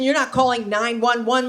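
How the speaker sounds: ordinary talk, nothing marked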